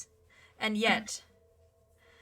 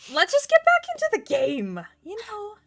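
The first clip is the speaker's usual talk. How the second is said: mock exasperated; 12 semitones higher, 7 dB louder than usual. Real, not acted.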